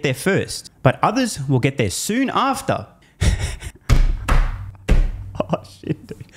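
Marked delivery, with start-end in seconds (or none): 3.2-5.3 sudden helpless laughter; breathy laugh. Real, not acted. Bursts out laughing at quote. 5.4-6.2 laughing. Real, not acted. Laughing disbelief after reading.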